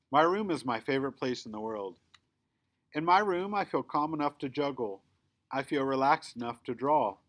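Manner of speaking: ordinary delivery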